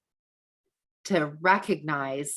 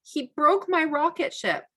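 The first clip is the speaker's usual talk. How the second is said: put-on upset little boy; 12 semitones higher than usual. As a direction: play the upset little boy whose rocket broke. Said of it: "he was very upset".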